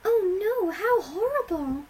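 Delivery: high, drawn-out distressed wail; whimpering. A narrator's reading. Fluttershy hearing of the beating, whimpering. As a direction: whimper it loudly, pitch way up. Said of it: "Fluttershy whimpered".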